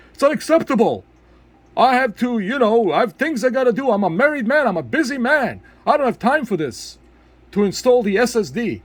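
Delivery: high, whiny-customer mockery; mock outraged complainer. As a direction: imitate an indignant buyer demanding a refund. Mocking a complaining buyer.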